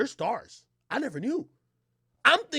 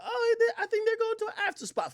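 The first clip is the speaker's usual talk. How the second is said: put-on much higher girly voice, light laugh; mimicking girlfriend; 14 semitones higher, 4 dB quieter than usual. Narrator imitating his date about the after party. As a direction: imitate her bubbly tone. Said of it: "She was like".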